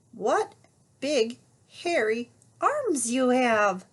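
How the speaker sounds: slow and careful; carefully. Acted. Little Red carefully asking the disguised wolf. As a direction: say it cautiously, drawn out. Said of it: "Little Red said carefully".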